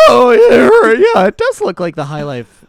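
mocking imitation. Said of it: "You're like".

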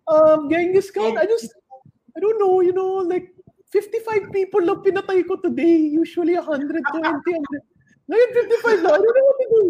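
high, squeaky skit voice; comic falsetto soldier; nervous warrior underling. Acted. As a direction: answer the boss in a high-pitched, hesitant voice. Skit voicing warrior answering Genghis.

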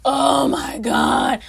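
slow, drawn-out, loud gush. Real, not acted. Vlogger gushing about gap teeth, slow and loud. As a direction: melt over it. Gushing over people with gaps.

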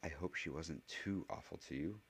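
hushed, confiding aside; conspiratorial. A narrator's reading. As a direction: drop the volume as if sharing a secret. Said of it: "her voice lowered conspiratorially".

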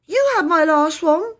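put-on high, indignant child. A narrator's reading. Phyllis answering Peter about hankies, indignant. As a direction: protest in a high-pitched voice. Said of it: "said Phyllis indignantly".

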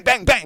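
mock gunshots. Gun sound imitation.

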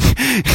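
high-pitched imitated laugh; imitating a high laugh. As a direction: mimic his giggly laugh. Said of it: "He was laughing away".

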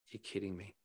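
very soft, stunned reply; quietly incredulous; hushed disbelief. Retold or imitated. Stunned reply to generous offer retold.

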